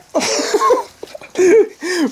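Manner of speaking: sudden high burst of laughter; startled laugh. Real, not acted. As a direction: laugh it off in surprise. Startled by bright light, laughs.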